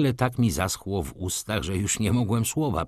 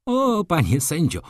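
high-pitched protesting exclamation; baroness's outcry; 9 semitones higher, 4 dB louder than usual. A narrator's reading. Baroness protests to the judge, high outcry. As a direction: cry out to him, pitched up. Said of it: "krzyknęła baronowa".

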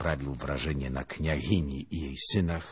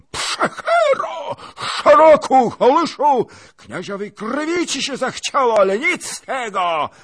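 very high, loud shrieking abuse; torrent of insults; 13 dB louder than usual. Acted. Princess hurls insults at the lieutenant, shrieking. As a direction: pour out helpless rage. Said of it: "potokiem obelg".

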